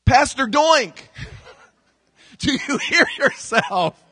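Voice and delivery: high laughing tease, slowed; wife teasing. Retold or imitated. Wife teasing her pastor husband, laughing. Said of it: "My wife's kind to me".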